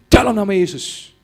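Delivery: loud, high rebuke in Jesus' name; commanding shout. Retold or imitated. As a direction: cast the spirit out with force. Commanding deliverance prayer. (bentakan keras mengusir setan)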